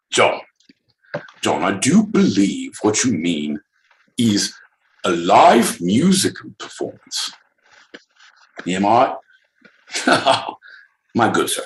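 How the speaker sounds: lightly voiced proper English gentleman; Queen's English. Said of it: "in the Queen's English".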